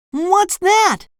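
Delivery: very high, awed cartoon voice; SpongeBob entranced. A narrator's reading. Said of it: "asked SpongeBob, entranced".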